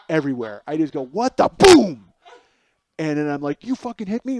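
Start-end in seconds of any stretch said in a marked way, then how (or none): 1.0-2.1 loud startled "What the?" then a booming "Boom!"; sudden shout. Retold or imitated. Punching his brother, loud startled shout. 3.7-4.4 higher, outraged yell; angry yell. Retold or imitated. Yelling at brother after dryer door hit, angry. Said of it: "And I'm yelling at him".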